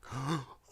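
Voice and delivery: high mock gasp of grief; imitated shocked gasp. Mocking him as if his grandfather had died, high gasp. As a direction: gasp as though news of a death came, hammed-up. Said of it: "figured out that his grandfather had died".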